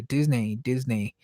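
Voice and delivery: Disney said twice as "Diz-nay"; Disney rhyming with "day"; habitual mispronunciation. Shown as a demonstration. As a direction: put "nay" at the end of Disney. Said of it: "that's how I would always pronounce it".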